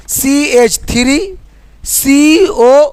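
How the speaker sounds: सी एच three, then सी ओ drawn out very slowly; right way, slow and spaced. Shown as a demonstration. Teacher to students, showing the right way: slow, spaced सी एच three सी ओ. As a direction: read each part slowly, stretching सी ओ. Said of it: "ऐसे इसको पढ़ेंगे".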